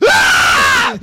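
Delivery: startled unvoiced yell; scream. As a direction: let out a sudden scream. Imagined scream.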